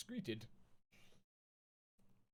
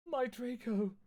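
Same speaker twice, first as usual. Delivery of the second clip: higher, hammed-up lament; mock moan; 10 semitones higher, slower than usual. Vampire mourning Draco, mock moaning. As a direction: wail for lost Draco, overdone. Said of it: "he moaned".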